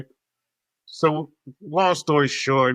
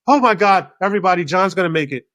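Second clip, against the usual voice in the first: high, loud, sudden exclaiming; hammed-up announcement of good news to everyone; mock elation; 4 semitones higher, 6 dB louder, faster than usual. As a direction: imitate an overjoyed, dramatic passenger. Retold sudden joy, tears gone.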